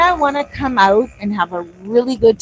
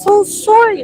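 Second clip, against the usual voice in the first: much higher sympathetic oh wow; gushing apology; 11 semitones higher, slower than usual. Retold or imitated. Food app support apologizing to the vlogger, high gushing. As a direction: gush like a sorry support agent. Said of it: "they were like".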